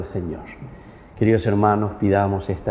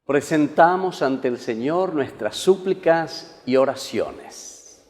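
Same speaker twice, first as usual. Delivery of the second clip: slow, solemn intoned prayer; liturgical prayer; 4 semitones higher, 3 dB quieter, slower than usual. Acted. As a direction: intone it like a priest at mass.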